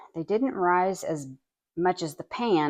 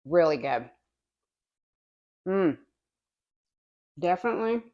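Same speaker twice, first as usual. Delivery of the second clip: mouth full. Real, not acted. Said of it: "Excuse me for talking with my mouth full".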